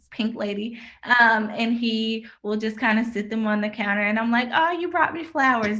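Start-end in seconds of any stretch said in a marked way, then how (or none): 4.5-5.8 higher, teasing thank-you; wife teasing her husband, playfully delighted; mock delighted. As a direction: pretend an apple bag is a bouquet. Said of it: "That's our ongoing joke".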